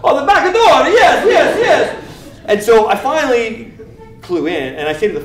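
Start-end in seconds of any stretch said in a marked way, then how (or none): 0.0-2.0 very high, loud mock yell; hammed-up eager hollering; frantic patient. Patient answering about the back door, frantic over-eager shouting.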